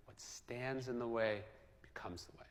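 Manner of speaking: softly, slowing down, fading to a whisper; quiet, reverent reading of an old maxim; hushed quote. Acted. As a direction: let the maxim sink in, dropping to a near whisper. Solemn quoting of Marcus Aurelius.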